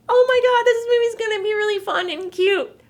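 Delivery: high, loud, gushing delight; squealing about a cute movie; mock gushing. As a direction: gush like a fan who can't wait. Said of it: "you're like".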